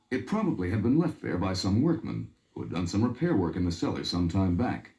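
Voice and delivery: usual speaking voice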